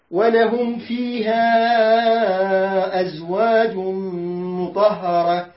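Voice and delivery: slow drawn-out recitation; Quran recitation. Shown as a demonstration. Teacher reciting the verse for the class. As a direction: recite slowly, lengthening the words. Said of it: "teacher recites verse".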